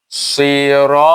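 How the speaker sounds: সিরা with the vowel stretched long and slow; long drawn sira. Shown as a demonstration. Teacher demonstrating to students, a long drawn সিরা. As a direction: draw out সিরা slowly, full-mouthed. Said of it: "এ খালি লম্বা করবে".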